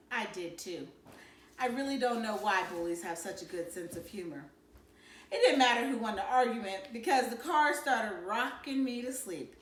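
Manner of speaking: usual speaking voice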